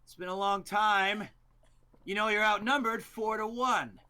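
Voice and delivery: high-pitched, projected promo voice; wrestling promo. Acted. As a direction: taunt an outnumbered rival theatrically. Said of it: "Promo back in".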